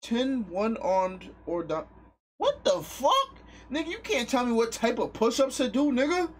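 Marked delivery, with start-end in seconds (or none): 2.4-6.3 indignant. Real, not acted. Indignant at chat's demand.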